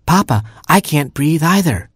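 high, frightened little plea; anxious Hamster. A narrator's reading. Hamster to Papa in the smelly cave, anxious. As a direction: appeal to Papa in a small high voice. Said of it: "whispered Hamster".

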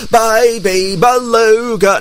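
slow, high, drawn-out call; mistaken lookout call. Acted. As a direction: stretch it out like a lookout.

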